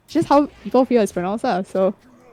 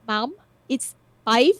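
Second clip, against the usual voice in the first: put-on Filipino accent. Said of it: "I can't do a Philippine accent".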